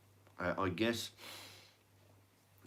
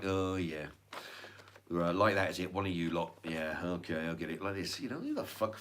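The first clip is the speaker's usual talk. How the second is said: sneering inspector; 4 semitones lower, 4 dB quieter than usual. Retold or imitated. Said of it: "they're like".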